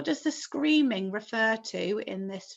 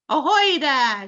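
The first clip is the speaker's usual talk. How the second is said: loud hailing call, drawn out; police chief shouting up; 5 semitones higher, 9 dB louder, slower than usual. A narrator's reading. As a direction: call up to the roof. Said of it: "shouted the chief of police".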